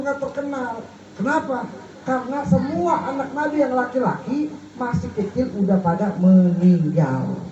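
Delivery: ordinary talk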